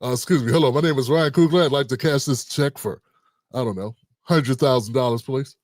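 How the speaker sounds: mock-proper voice, pitched well down; Ryan Coogler, imagined polite. Imagined customer asks bank teller for cash, politely. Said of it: "We all thought he went in there like that".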